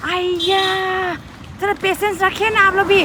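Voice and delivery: high, snappy impatience; irritated nurse. Retold or imitated. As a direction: mimic the nurse's annoyed tone. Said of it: "ऐसे बोल के बोल रहे ना". (चिढ़ी हुई नर्स की नकल)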